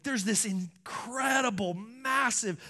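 ordinary delivery

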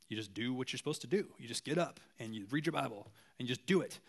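low, soft, matter-of-fact delivery; understated no-nonsense pastor impression; John MacArthur. Pastor imitating a pragmatic colleague for the congregation, matter-of-fact. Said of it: "like, pragmatic".

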